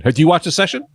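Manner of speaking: ordinary talk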